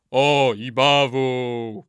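slow, loud crowd drone; chorus repeating. A narrator's reading. Chorus repeats the old man's curse, drawn-out chant. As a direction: echo as a chanting choir. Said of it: "powtórzył chór".

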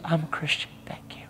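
soft unvoiced whisper, barely there; whispered thanks. Flight attendant thanking the preacher, in a hushed whisper. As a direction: breathe it out like a secret.

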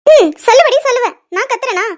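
loud, high, furious comeback; angry retort. Acted. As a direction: snap back loudly in anger. Tara retorts angrily at accusation.

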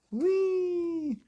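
very high, long squeal of glee; gleeful whee. A narrator's reading. Gleeful slide off roof.

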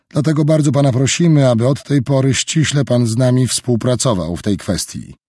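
smooth veiled pressure; Roberts voiced. A narrator's reading.

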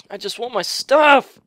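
loud, high-rising complaint; frustrated whine. Real, not acted. Gamer wants lost items back, frustrated yell. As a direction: burst out in exasperation. Frustrated after dying again.

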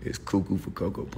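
softly voiced aside; quiet aside. Real, not acted. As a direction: toss it off quietly to yourself. Muttered slogan, then said aloud.

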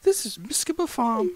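high-pitched British character voice; British accent. Acted. Carpenter leads the newcomer to the farm, high British accent. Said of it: "I don't know why I'm British".

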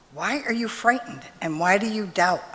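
ordinary talk, nothing marked

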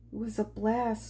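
ordinary talk, nothing marked

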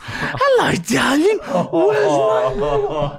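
put-on very high sugary baby talk, slowing down; fake sweet voice. Owner sweet-talking the dog in public, high sugary coo. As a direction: coo at the dog while seething underneath.

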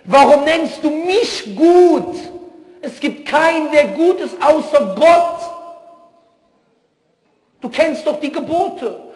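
high, slow, loud voice of a gentle teacher; Jesus voiced. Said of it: "jetzt sagt Jesus".

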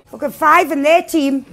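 ordinary delivery

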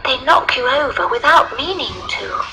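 put-on high little girl voice. A narrator's reading. Little girl explaining to the lion, high child voice.

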